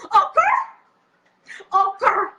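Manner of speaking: high, loud delighted shriek; excited squeal. Real, not acted. Hyped reaction to comment.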